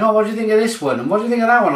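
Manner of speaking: mock-eager shop assistant pushing scents; imitating perfume staff. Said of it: "and they're like".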